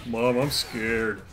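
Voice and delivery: slow, small mock-scared child voice; scared kid. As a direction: drag it out like a frightened kid, lightly voiced. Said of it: "the eleven-year-old's like".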